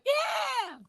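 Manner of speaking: high excited whoop; imitating their clueless childhood selves, a high excited yeah; mock kid's cheer. High mock yeah. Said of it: "we were just like".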